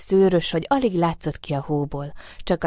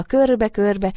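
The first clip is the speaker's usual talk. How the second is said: ordinary delivery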